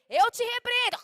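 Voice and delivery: loud high mock rebuke; rebuking shout. Preacher acts a church worker rebuking a demon, mock shout. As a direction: cast the demon out with a mock yell.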